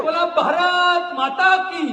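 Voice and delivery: high, loud rallying shout; Shivgarjana battle call. Real, not acted. Orator leading the crowd's Mahadev response, high rallying shout. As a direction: throw out the cry for the crowd to answer. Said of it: "एक शिवगर्जना करतो".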